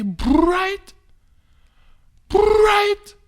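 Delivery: loud, very high, drawn-out BRIGHT; boastful crowing. Acted. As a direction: crow it out slowly, high and loud. Comic boasts of school days.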